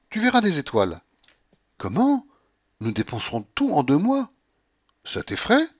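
flirtatious, rising to squeals; playful coquette. Acted. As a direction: coax him over, giggly and bold. Blanche voiced by reader.

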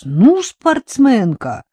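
slow, lifted wondering exclamation; admiring. A narrator's reading. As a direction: marvel aloud, stretching the words. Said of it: "восхищённо пробормотал Стёпка".

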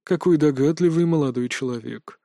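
mildly indifferent remark; cool, detached understatement. A narrator's reading. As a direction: say it without caring. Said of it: "безразлично произнёс голос".

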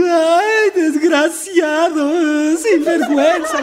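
chillido agudo y fuerte de rabia; grítale el insulto con furia y risa; indignada. Acted. Paisana furiosa tras el asalto. (grito agudo e indignado de la paisana)

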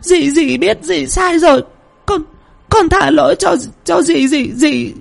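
high, slow, crying plea; bà Hai weeping to the ghost; tearful apology. Acted. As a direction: beg forgiveness through sobs. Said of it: "miệng không ngừng lẩm bẩm".

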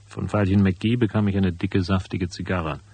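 lauter, nüchterner Erzählton zum Schluss; Erzählstimme. A narrator's reading. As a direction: berichte das Ende wie ein Erzähler. Erzähler-Epilog nach der Szene. (ruhig erzählender Epilog)

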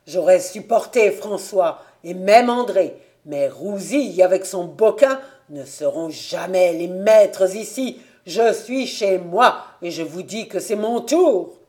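loud, vehement claim; violent anger. A narrator's reading. Invalid son claiming the farm from his father, violently angry. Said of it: "L'infirme continua avec la même violence".

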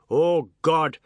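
loud, high theatrical bawl. Acted. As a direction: bellow it out in melodramatic outrage. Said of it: "He bawled".

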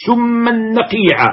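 سما نقيعا drawn out slowly, سما held long and high; verse chanted slowly; slow recited line. Shown as a demonstration. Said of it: "فاسقها سما النقيع".

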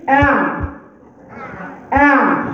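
"أع" with a throaty ain, voiced but not full; ain demo. Shown as a demonstration. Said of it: "اما اقول لك مثلًا ايه".

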